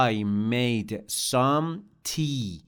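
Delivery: I made some tea, slow with weight on some; slow model sentence. Shown as a demonstration. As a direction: dictate it gently for the class.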